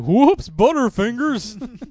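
high, slow goofy hero voice; drawn-out mocking superhero line; mock Thor voice. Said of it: "He's like".

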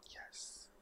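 hushed, whispered acted-out yes; whispered approval. Watching castmate at table read, whispered approval. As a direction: whisper it in awed agreement.

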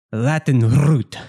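bad Count Dracula accent; slow, hammy faux-Latin delivery. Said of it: "Is that supposed to be a Latin accent? 'Cause it was dreadful".

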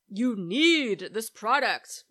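ad pitch voice. Retold or imitated. Mocking the ads on TV, in a slow pushy sales voice. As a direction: sell it like a pushy TV ad. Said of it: "Most of them say something like".

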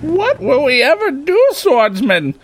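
high pleading heroine voice; distressed heroine. Acted. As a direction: cry out like a helpless damsel. Hero cries out in despair.

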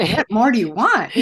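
high, loud, laughing outburst; laughing disbelief. Retold or imitated. Therapist laughing at a refused assessment, disbelieving.

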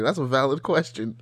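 usual speaking voice

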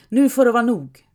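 high, loud final order; thundering. A narrator's reading. Anders Knote ending the bickering, loud. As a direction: roar it to end the quarrel. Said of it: "dundrar Anders Knote".

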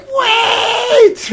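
high panicked yell after brother; panicked shout. Retold or imitated. Child left behind by brother, shouting panicked. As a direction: shout wait as the dog closes in. Retold childhood dog chase, calling out.

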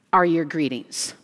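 ordinary talk, nothing marked

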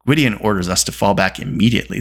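ordinary delivery, nothing marked

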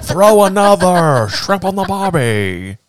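loud, high, drawn-out Aussie parody; terrible Australian accent. Pretend. Friend topping the joke about Australia cheese, over-the-top Australian accent. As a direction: ham up a slow, shouted Australian accent. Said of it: "That's your worst accent".

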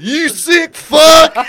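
loud, slow, high disgusted yell; mock disgusted shout. The passing driver yelling at him, mock shouted.

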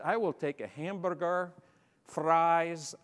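British English, rolled R's. Retold or imitated. Newcomer ordering at Hardee's, in British English with rolled R's. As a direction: roll the R's in hamburger and fries, British-style. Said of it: "rolling my R's".

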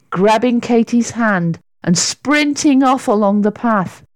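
ordinary delivery

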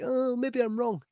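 high, slow, doubtful mock voice; mock unsure psychic. Mocking a psychic who isn't sure, high and slow. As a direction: ham up a hesitant second guess. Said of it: "He wasn't like".

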